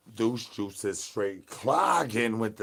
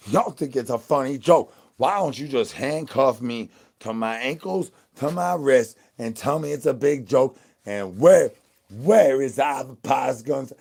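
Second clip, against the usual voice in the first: loud hammed-up indignant yelling at chat; mock angry rant; 4 semitones higher, 10 dB louder than usual. Streamer yelling at chat for laughing, hammed-up fury. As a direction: blow up at the chat over their joke.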